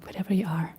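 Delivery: normal talking voice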